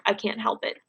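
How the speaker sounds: whispered, holding in a laugh; whispered aside. Acted. Narrator unable to hold back laughter, whispering the line. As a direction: breathe it out under your breath.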